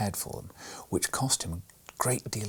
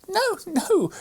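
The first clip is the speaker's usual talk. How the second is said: put-on high, loud child's protest; much higher, louder little-girl cry; 7 dB louder than usual. A narrator's reading. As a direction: cut him off with a squealing no. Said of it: "cried Maria, interrupting him".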